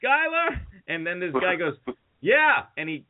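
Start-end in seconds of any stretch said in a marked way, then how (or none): 0.0-0.6 very high mock call; yelling across street. Hammered man hailing nobody across the street, mock yell. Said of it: "I'm yelling across the street". 2.2-2.6 high, imitated answering holler; calling back. Stranger answering to his name across the street, mock holler.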